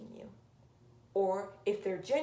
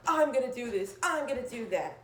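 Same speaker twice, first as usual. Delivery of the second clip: mock angry rant; 5 semitones higher, 5 dB louder than usual. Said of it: "start screaming at the top of your lungs".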